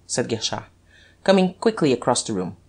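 usual speaking voice

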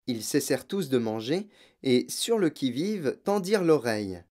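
ordinary delivery

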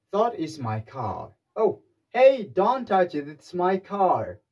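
slow, loud, high warning off someone; guard the car and scold, very slow; mock angry owner.

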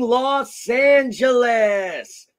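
drawn-out, booming radio DJ call; radio greeting. Acted. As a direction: stretch every word like a morning DJ. Podcast intro, radio-style greeting.

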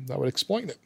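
normal talking voice

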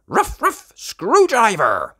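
high double bark, slow call; barking pup. Acted. Rocky summons his screwdriver with high barks.

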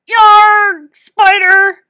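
sudden loud yell of fake alarm; loud shout. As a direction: shout it to scare the girls. Boy scares with rubber spider.

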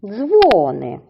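Дзвони with a long stressed ДЗВО; drawn-out DZVOO-ny; stress on first syllable. Shown as a demonstration. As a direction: stretch дзво, drop ни in Дзвони. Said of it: "наголошуємо на перший склад".

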